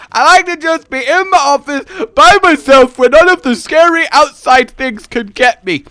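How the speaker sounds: high, loud, cowering plea; mock panic at the outside world; hammed-up fearful whine. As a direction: wail it like a frightened recluse. Hammed-up plea to be left alone.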